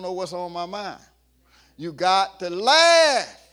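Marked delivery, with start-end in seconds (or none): 1.8-3.4 each word drawn out, louder; slow emphatic urging. Acted. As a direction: lean on it slowly and loud, preaching. Preacher urging congregation.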